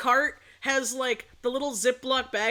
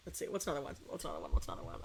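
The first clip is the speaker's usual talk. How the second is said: very soft muttering to self; thinking aloud; 8 semitones lower, 12 dB quieter, much faster than usual. Real, not acted. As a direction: mumble it while searching memory. Thinking aloud for another story.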